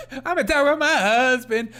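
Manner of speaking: high, loud mock gushing; mocking impression. Host mimicking the speaker in the clip, loud mockery. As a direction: parody her gushing announcement at full volume.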